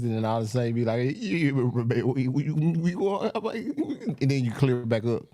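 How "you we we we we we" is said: choppy breaking-up call audio; laggy internet voice. Shown as a demonstration. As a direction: stutter like a frozen video call. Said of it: "you be talking like this".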